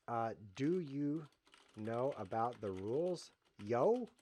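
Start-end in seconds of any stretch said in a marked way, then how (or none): 3.6-4.1 "Yo?" tossed up high, playing cool; a hammed-up slang greeting; mock cool guy. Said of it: "show that I'm a cool guy".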